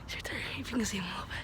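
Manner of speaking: breathless unvoiced whisper. Real, not acted. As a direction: hush it so he can't hear. Hiding near opponent.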